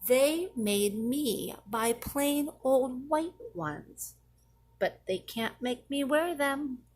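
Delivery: ordinary delivery, nothing marked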